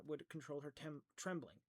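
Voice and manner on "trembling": plain reading voice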